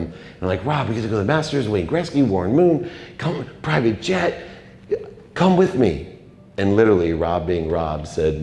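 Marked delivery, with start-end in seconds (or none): 0.6-6.2 high, eager invitation to a friend; super excited. Retold or imitated. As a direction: excitedly talk him into coming along. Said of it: "I'm super excited to be able".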